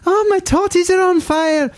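extremely high, squeaky Glaswegian. Acted. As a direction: wail it in falsetto Glasgow caricature. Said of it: "It's got to be higher".

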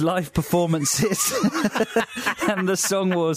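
can't hold back a laugh. Real, not acted. Host laughs while plugging the event to his co-host. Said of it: "Nice enthusiasm".

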